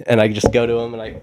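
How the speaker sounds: normal talking voice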